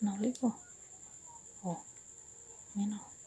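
hushed whisper while fiddling; whispered to herself. Real, not acted. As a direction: whisper it under your breath. Whispering that battery is dead.

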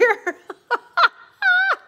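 real high-pitched burst of laughter; loud high laugh. Real, not acted. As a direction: laugh out loud at your own naivety. Laughing at own career expectations.

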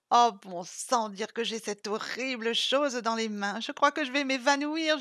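plaintive, overwrought whining; plaintive moan. A narrator's reading. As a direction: moan it as if about to faint. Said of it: "gémit Isa d'une voix plaintive".

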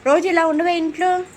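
high, loud motherly jab; scolding reproach. Real, not acted. Mother scolds silent child.

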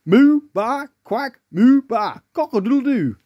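high, loud run of farmyard calls; animal noise imitations. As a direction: demonstrate each animal noise down the phone. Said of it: "All of our students are going".

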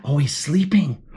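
ordinary delivery

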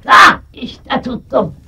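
loud, slow triumphant "got you"; triumphant shout. Acted. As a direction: crow it as you grab the cat. Catching the cat. (zafer çığlığı)

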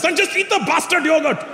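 put-on high, Indian-accented order; imitated dad losing patience, Indian accent; exasperated Indian dad. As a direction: snap like a fed-up Indian father. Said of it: "My dad goes".

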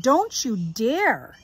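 slow, firm warning; stern warning. Acted. Sister warning brother not to go, slow and firm. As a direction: stop him cold, slowly.

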